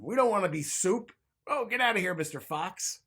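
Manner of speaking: high, loud shooing yell; indignant turtles. A narrator's reading. Turtles chase the fox with high, loud shouts.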